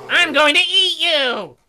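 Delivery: high, loud, slow bear roar; booming threat. Acted. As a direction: roar the threat so it fills the cave. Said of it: "His voice boomed".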